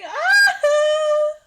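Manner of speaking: high loud half-laughing wail; hammed-up sob-shout; mock crying wail. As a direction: wail out in fake despair. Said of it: "Not me crying".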